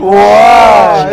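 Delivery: long drawn-out yelled wow; loud shout. Real, not acted. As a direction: stretch it into a big shout. Reacting loudly to the clip. (فریاد کشیده و بلند)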